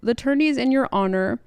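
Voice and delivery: high-pitched voice of someone piping up, imitated; Septa Mordain. Septa Mordain persuading Ned about his daughters, piping up high. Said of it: "Septa Mordain kind of pipes up".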